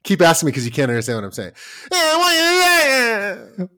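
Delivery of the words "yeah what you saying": put-on high, loud little-kid voice; a child mumbling through tears; mimicking a small child. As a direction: squeak it like a toddler.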